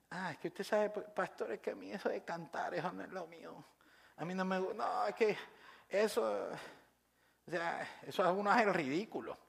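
soft, hesitant mock excuse; imitating a shy member begging off, very quietly; reluctant churchgoer. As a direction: mumble a sheepish excuse, trailing off. Voicing a reluctant, embarrassed churchgoer.

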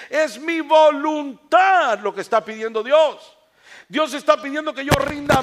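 loud, high, driving sermon delivery; full-force preaching. Real, not acted. Preacher urging the congregation to surrender the will, full-force preaching. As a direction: build to a forceful preacher's shout.

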